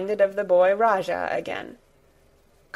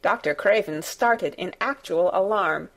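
plain reading voice

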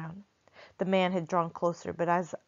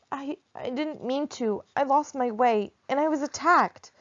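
put-on boy caught out, pleading and scared; flustered boy; 8 semitones higher, 4 dB louder than usual. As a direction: stumble to explain while shaken. Frightened boy explains himself.

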